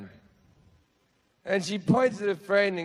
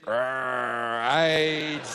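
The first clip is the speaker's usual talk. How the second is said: low, snarling pirate growl; mock aggressive snarl; 7 semitones lower than usual. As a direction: growl it like a monster. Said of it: "the aggressive".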